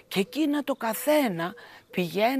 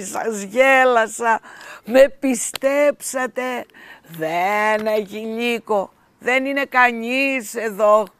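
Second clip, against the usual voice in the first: louder, slow teasing gloat; mocking; 9 dB louder, slower than usual. A narrator's reading. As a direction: rub it in, laughing at them. Shepherd mocks villagers.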